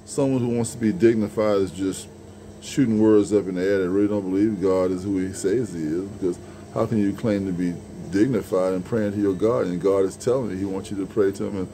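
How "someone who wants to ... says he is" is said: usual speaking voice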